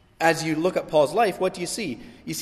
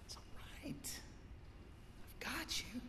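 soft high reassuring whisper; tender whisper; 12 semitones higher, 24 dB quieter than usual. Pretend. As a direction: hush a child in a hospital bed. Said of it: "he's going".

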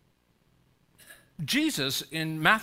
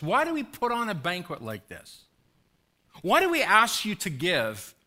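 raised, louder fiery preaching; preaching at full force; 6 semitones higher, 5 dB louder than usual. Acted. Pastor urging the congregation about the banquet, fiery preaching. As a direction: drive the point home from the pulpit.

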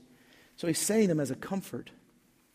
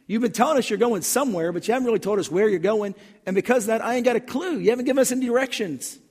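Thomas raised high and loud, exasperated; frustrated disciple demanding directions; voicing Thomas; 7 semitones higher, 5 dB louder, faster than usual. Preacher voicing Thomas, frustrated and pleading, high and loud. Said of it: "That's what Thomas is saying".

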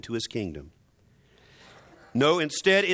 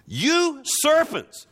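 much higher, very slow, louder hammed-up denunciation; mock thundering prophet; 11 semitones higher, 4 dB louder, much slower than usual. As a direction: stretch it out like a prophet cursing the crowd, half in jest. Mock-thundering Jesus insult.

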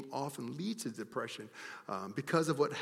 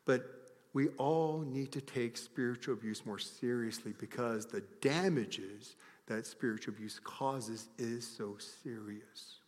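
ordinary talk, nothing marked

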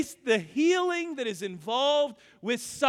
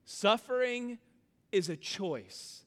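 usual speaking voice

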